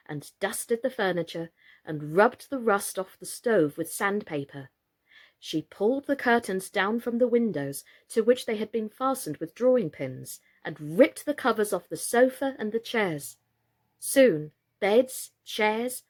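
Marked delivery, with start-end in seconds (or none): none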